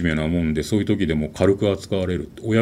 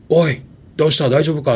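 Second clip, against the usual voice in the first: higher, slightly louder soldier's call from afar; 7 semitones higher, 3 dB louder than usual. Retold or imitated. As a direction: call out to the exhausted boy, concerned. 軍人の声が再び聞こえる. (疲れ果てた少年に呼びかける軍人の声)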